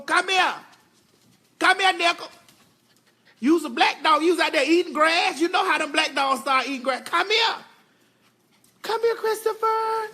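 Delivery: loud, high call to the dogs. Real, not acted. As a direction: call them in across the yard. Calling the dogs again.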